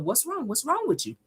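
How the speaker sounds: soft, hushed what's wrong; hushed concern. Retold or imitated. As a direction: ask it close in her ear. [name] leaning in whispering.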